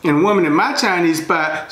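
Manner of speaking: ordinary delivery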